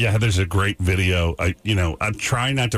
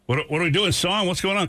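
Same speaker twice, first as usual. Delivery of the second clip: retold alarm; 10 semitones higher than usual. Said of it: "and I said".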